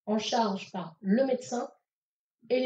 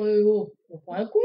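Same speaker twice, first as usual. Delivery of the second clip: louder gruff mock grumble; imitating father; 7 dB louder than usual. Father startled by the order, mock gruff grumble. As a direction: do dad's grumpy comeback.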